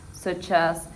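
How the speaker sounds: usual speaking voice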